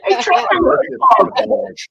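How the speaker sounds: high, slow sweet granny voice; old lady offering meatballs. Retold or imitated. Brewer imitates old lady feeding a boy, high slow granny voice. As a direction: coax like a granny with a plate. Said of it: "like an old lady giving meatballs".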